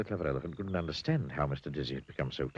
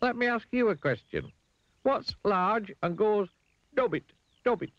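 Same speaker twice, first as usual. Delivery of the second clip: very high, deliberate challenge; Mister Dizzy; 4 dB louder than usual. A narrator's reading. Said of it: "said Mister Dizzy".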